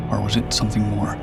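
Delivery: usual speaking voice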